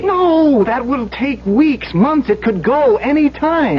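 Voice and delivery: urgent alarm. Acted. Engineer warning his boss of disaster, urgent and high. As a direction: push the danger hard to your boss. Engineer insists mountain will collapse.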